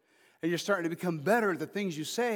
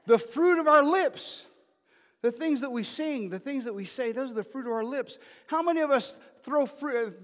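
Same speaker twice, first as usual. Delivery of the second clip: fiery preaching; 8 semitones higher, 7 dB louder than usual. Real, not acted. Pastor preaching to the congregation, loud and high. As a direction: lift the phrase high over the room.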